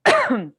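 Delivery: hard throat clear after choking. Real, not acted. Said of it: "of course I take a sip of water and it goes down the wrong pipe".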